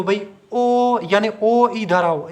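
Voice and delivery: ओ drawn out slowly like a call; calling out long. Shown as a demonstration. Teacher imitating kids calling a brother, stretched ओ. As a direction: call ओ across the house, stretched. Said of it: "आप ओ की आवाज़ खींचते हो".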